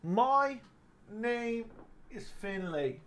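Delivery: hammed-up Irish accent, hushed start swelling very loud; Conor McGregor accent. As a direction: drag out each word in a high mock Irish accent. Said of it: "How about that one?".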